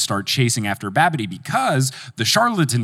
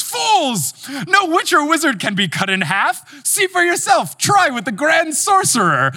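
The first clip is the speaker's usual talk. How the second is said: witch taunting. Retold or imitated. Witch in the stump taunts the fools chasing her, hammed-up high jeer. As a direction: crow it down at them like a gloating witch from the stump. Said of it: "She goes".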